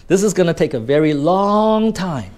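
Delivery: long held out before "time"; elongated long. Shown as a demonstration. Teacher showing students emphasis, long prolonged. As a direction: stretch the vowel of long right out. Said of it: "you actually elongate that word".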